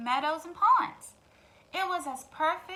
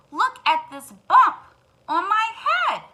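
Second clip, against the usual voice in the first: higher, loud, slow insistence; insistent hen; 8 semitones higher, 13 dB louder than usual. Acted. Hen insists to the duck. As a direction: make him look at the bump. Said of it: "said Henny Penny".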